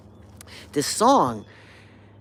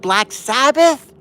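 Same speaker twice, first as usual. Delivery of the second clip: guessing wrong; 9 semitones higher, 10 dB louder, slower than usual. Retold or imitated. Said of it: "And I said".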